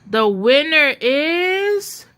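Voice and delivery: slow, drawn-out build before the name; announcer teasing the crowd before naming the winner, slow and drawn out; suspenseful pause. A narrator's reading. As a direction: hold them in suspense.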